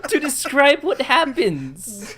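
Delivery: high, shouted exasperation; loud protest. Real, not acted. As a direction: yell over the laughter in your own defence. Protesting over teasing about his turn.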